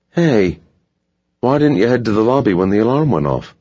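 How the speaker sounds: firm questioning man's voice; stern security guard. A narrator's reading. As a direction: demand an answer like a guard.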